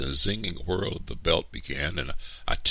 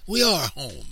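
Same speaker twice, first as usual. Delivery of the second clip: put-on higher-pitched defiant child; defiant retort; 10 semitones higher, 4 dB louder than usual. A narrator's reading. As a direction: talk back to the grown-up. Child defies the man.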